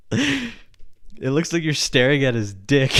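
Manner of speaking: slow, amused read of a comment; reading through laughter. Retold or imitated. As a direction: hold back a laugh while reading it out. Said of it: "look what this person said".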